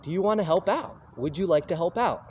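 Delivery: eager invite. Retold or imitated. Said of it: "He's like".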